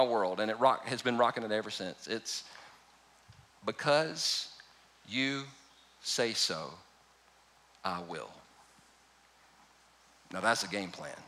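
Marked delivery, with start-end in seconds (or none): 3.6-8.3 much softer, drawn-out and lower; slow hushed reverence. Shown as a demonstration. Preacher savouring the key phrase, soft and slow. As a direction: let each word land quietly. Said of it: "But that phrase right there".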